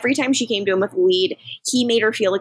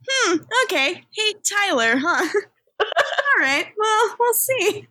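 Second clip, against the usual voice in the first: high, sing-song sceptical tease with laughs; knowing teasing; 10 semitones higher, slower than usual. Retold or imitated. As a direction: tease like you know better. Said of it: "I was like".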